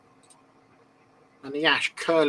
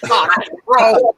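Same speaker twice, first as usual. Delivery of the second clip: mock outrage; 7 semitones higher, 13 dB louder, slower than usual. Real, not acted. Co-host reacting to a cheeky joke.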